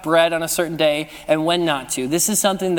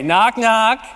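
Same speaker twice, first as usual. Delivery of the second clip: bright, raised call to the room; playful joke opener, raised; called-out knock knock; 4 semitones higher, 4 dB louder than usual. Pretend. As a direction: call it out so everyone answers. Leading congregation in knock-knock joke.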